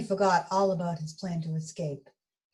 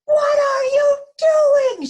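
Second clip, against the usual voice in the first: a high, crying scream, much louder; terrified shout; 12 dB louder, slower than usual. Acted. Donut Arnie screams at Mister Bing about to eat him, high panicked shout. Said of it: "shouted Arnie".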